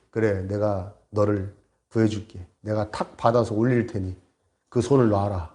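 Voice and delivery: low, measured divine voice; voice of God. Retold or imitated. God telling the hanging pastor to let go, low solemn voice. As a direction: promise rescue with solemn calm. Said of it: "음성이 딱 들려온 거예요".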